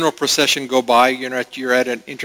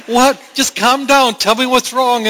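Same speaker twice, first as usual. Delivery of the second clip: higher, coaxing mom asking what's wrong; a mom pleading with her toddler; mother voiced; 10 semitones higher, 3 dB louder than usual. Mother coaxing her crying toddler, raised pitch.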